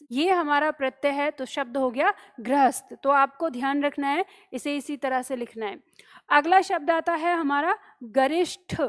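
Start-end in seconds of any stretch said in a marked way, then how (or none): none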